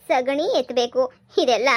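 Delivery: ordinary talk, nothing marked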